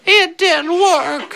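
put-on very high, drawn-out little-kid complaint; slow, squeaky imitation of a let-down child; little brother voice. Quoting the disappointed little brother.

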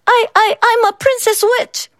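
put-on high, squeaky little girl voice, stammering; shy small girl, stuttering in a squeak; soft and squeaky. A narrator's reading. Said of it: "Megan's voice was soft and squeaky".

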